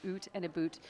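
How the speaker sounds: "oot" and "boot" with OO for OW; mimicked Canadian vowels. Coach imitating Americans mimicking Canadians, "oot and a boot" with OO. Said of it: "They do mimic us by saying".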